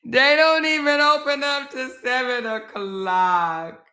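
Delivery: high, slow mock lament; mock wailing. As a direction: drag every word out in a fake whine. Lamenting the late opening time.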